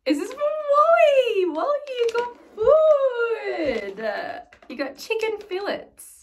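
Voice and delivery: loud, very high squeal; excited pet talk. Real, not acted. Telling Wally about his treats, high loud squeal. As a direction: gush to a pet, stretching the words.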